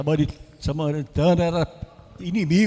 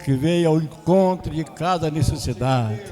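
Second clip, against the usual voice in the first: louder, fervent prayer voice; closing prayer; 6 dB louder than usual. Acted. Pastor praying aloud for the congregation, fervent and louder. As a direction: pray over the church with rising intensity.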